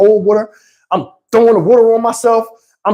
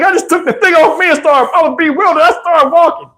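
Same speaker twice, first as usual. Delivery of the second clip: ordinary talk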